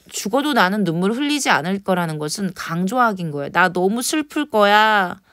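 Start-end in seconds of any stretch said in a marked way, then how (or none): none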